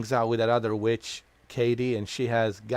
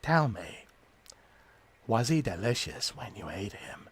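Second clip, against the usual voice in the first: whispered taunt, breathy and close; creepy whisper; 4 dB quieter than usual. Pretend. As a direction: hiss the question like a creep. Taunting the game character creepily.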